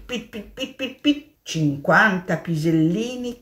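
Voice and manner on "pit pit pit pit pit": high little pits; quick high pellet sounds; pit noises. Acted. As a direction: tap out tiny pits. Hare demonstrates droppings.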